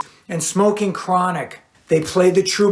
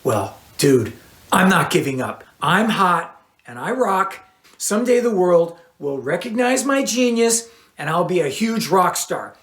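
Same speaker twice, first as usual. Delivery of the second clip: ordinary delivery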